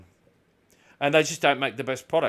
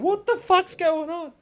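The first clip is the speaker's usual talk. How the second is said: much higher and slow, mock-panicked; bewildered; 6 dB louder, slower than usual. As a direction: stretch it out in startled disbelief.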